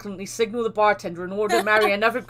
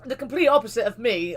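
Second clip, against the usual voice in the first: ordinary talk, nothing marked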